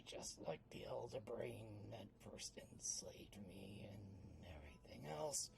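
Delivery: soft, low unvoiced whisper; bitter whisper. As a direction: mutter it bitterly. [name] bitter quiet reply.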